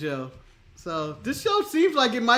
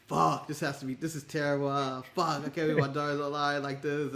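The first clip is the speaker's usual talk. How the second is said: softer, lower private dismay; inner thoughts; 7 semitones lower, 8 dB quieter than usual. Retold or imitated. Father's secret thoughts about his daughter, quietly horrified. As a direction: think it to yourself, aghast. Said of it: "in the back of her dad's mind".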